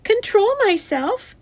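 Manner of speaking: high, loud, indignant echo; yelling. A narrator's reading. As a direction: throw it back as an outraged yell. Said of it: "yelled Fern".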